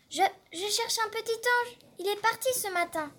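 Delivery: put-on high, stammering little-boy voice; shy boy. A narrator's reading. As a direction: stammer it out small. Boy answers the grape carrier.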